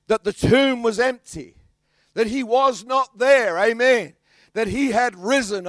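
loud, driving preacher proclamation; full-force preaching, loud and building. Acted. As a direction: lift it up and drive it home to the congregation.

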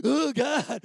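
moved and embarrassed; a retold half-sob; overcome oh God. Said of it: "it went from the ... to the".

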